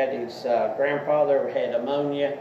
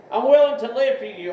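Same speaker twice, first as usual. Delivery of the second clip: "willing" pushed higher and louder; more fervent repeat; 9 semitones higher, 5 dB louder than usual. Acted. Preacher repeating the prayer line, more fervent. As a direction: lift the repeat with more fervor.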